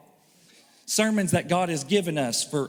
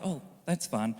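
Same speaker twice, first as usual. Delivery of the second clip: soft, lower mock calm; deceptively calm; 6 semitones lower, 7 dB quieter than usual. Woman imitated to warn the men, deceptively calm. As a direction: imitate ominous calm, low and hushed. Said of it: "when a woman calmly is like".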